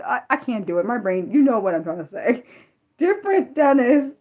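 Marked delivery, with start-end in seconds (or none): 2.7-4.2 laughing through words. Real, not acted. Laughing at own mispronunciation.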